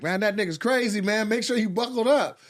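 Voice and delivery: high, hammed-up warning to buckle up; a friend's excited caution, pitched high; mock alarmed friend. Friend warning Quake to buckle up, hammed-up high voice. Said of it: "He's like".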